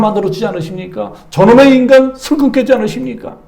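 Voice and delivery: mock angry complaint, rising; complaining prayer. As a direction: rant at God in frustration. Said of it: "원망 불평을 했다고".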